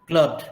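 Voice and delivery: "क्लब्ड" with d straight after b; word alone, "क्लब्ड" closed on voiced d; d-ending demonstration. Shown as a demonstration. Teacher giving a second example of the ending, pronunciation demonstration. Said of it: "as a end sound".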